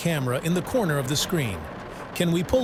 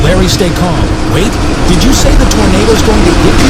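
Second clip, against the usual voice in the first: much louder, urgent call over the noise; alarmed shouting; 2 semitones higher, 16 dB louder than usual. Acted. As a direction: yell to reach him through the storm. Anchor yelling to panicked spotter.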